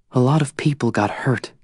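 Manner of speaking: a hint of Tris accusing him; Tris, hurt. Narrator voices Tris.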